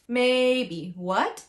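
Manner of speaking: "maybe" level, then "what" sweeping up; first-second tone model. Shown as a demonstration. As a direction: hold "maybe" even and lift "what" steeply. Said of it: "First and second".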